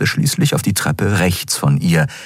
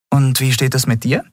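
Endriel asking; 8 semitones higher than usual. Acted. Endriel asks Miko.

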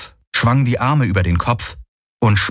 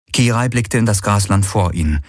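ordinary delivery

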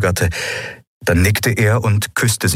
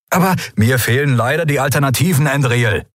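fast, higher-pitched agitated retort; barking; 7 semitones higher than usual. A narrator's reading. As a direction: bark it back, rapidly. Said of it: "bellte er". (aufgebracht gebellt)

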